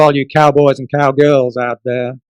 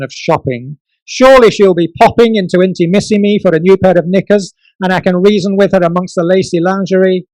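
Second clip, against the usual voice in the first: dramatic spoken-word recitation; poetry performance; 5 semitones higher, 7 dB louder than usual. Acted. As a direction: perform it like a pleading love poem. Performance poem to Miranda.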